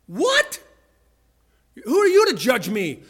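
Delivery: hammed-up outrage, pitch leaping; indignant retort. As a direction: act out someone taking sudden offence. Acts offended listener's retort.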